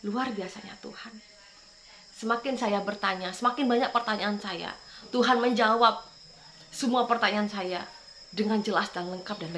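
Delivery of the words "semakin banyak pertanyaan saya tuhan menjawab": usual speaking voice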